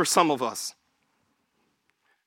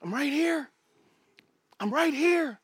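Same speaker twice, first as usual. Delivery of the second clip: soft, higher, soothing voice; patient reassurance; 5 semitones higher, 7 dB quieter than usual. Acted. Parent to a crying kid, soft and patient. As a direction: soothe gently, leaving space between. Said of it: "and you're saying".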